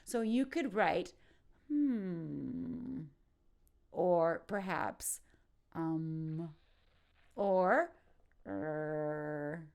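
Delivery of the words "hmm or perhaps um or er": uncertain hmm. Shown as a demonstration. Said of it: "expressing uncertainty".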